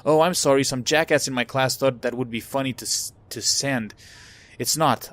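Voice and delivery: usual speaking voice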